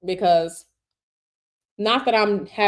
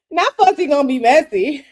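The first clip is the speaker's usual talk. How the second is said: laughing; 4 semitones higher, 6 dB louder than usual. Real, not acted. Laughing at chat comment.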